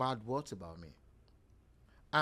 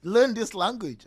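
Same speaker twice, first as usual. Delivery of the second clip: astonished client; 9 semitones higher, 10 dB louder than usual. Retold or imitated. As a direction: sound floored by the surprise. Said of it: "My client was like".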